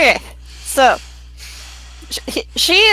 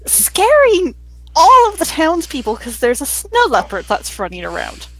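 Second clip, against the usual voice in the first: ordinary delivery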